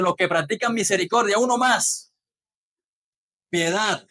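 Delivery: ordinary delivery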